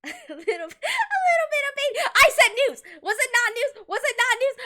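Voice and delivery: high, loud laughing protest; loud giggly defence of the title. Real, not acted. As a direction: laugh through it, teasing the chat.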